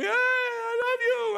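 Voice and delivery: high, drawn-out mock scream; jealous lover. Jealous lover outside the door, mock-screaming high and slow. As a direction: wail it like a jilted lover at the door.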